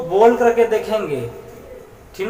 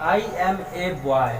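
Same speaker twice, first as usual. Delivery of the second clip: put-on every word of I am a boy read very slowly; teacher reading the English example slowly; slow model reading; 4 dB quieter, much slower than usual. Shown as a demonstration. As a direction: read it out slowly for the class to copy. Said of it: "example देखिए".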